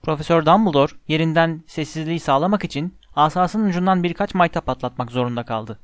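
ordinary delivery

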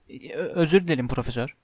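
stammered, low and soft apology; sheepish apology. A narrator's reading. Commentator caught out by the professor, sheepish apology. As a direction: stumble meekly into an apology.

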